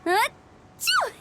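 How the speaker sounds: high-pitched acted sneeze. Friend sneezes during late-night moon watch, high and sudden. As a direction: let out a quick "achoo". Character sneezes in game scene.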